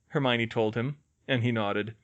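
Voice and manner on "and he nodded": plain reading voice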